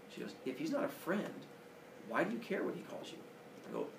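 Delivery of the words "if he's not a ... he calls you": higher, lightly voiced mother probing; mom's gentle question. Mother gently questioning her bullied son, higher voice. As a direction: lightly play mom, higher, asking patiently.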